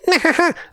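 high cackling laugh; mad scientist cackle. Acted. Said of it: "je te fais une imitation de savant fou".

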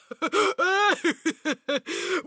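high chuckling creature laugh; rolling put-on chuckle; amused ogre laugh. A narrator's reading. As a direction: chuckle heartily in character.

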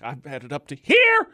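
mock exasperation, leaping up on the last word; fed up. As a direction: act like you have finally snapped. Acting a fed-up tax preparer.

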